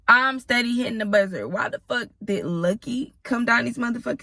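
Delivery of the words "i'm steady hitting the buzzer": usual speaking voice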